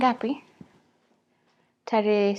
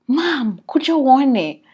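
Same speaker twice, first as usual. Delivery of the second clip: loud excited call to Mom; 4 semitones higher, 9 dB louder, much slower than usual. Retold or imitated. Calling Mom from the kitchen to come see, loud. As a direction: shout to her from the kitchen. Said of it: "Nili scream".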